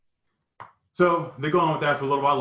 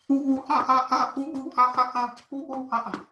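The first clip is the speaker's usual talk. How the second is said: high, jeering ape calls; taunting monkey noises, much higher; mocking monkey hoots; 13 semitones higher than usual. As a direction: hoot like a monkey to humiliate them.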